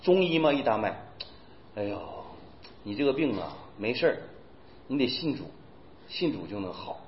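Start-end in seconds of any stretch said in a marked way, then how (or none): 1.8-7.0 lightly voiced old doctor, soft and calm; a hint of a gentle old healer reassuring. Old healer gently reassures a patient, soft and calm. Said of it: "人家看病怎么看吗".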